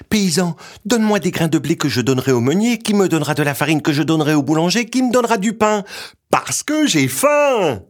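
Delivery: put-on high, fast child's breathless chain; small boy voice. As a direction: rattle off the list like a little boy. Petit bonhomme asks the farmer.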